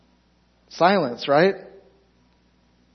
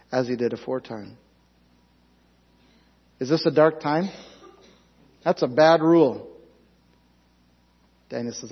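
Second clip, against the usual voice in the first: ordinary delivery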